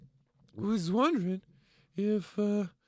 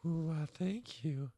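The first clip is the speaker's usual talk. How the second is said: coy thanks; 5 semitones lower, 8 dB quieter, slower than usual. Acted. Drag character coyly thanks.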